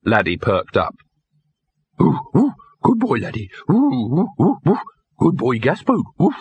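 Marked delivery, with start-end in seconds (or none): 2.0-6.3 high yipping words; excitable simple dog. A narrator's reading. Chained dog greets his companion, eager yipping. Laddie perks up and barks.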